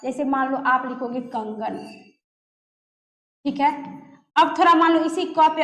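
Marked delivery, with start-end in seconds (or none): none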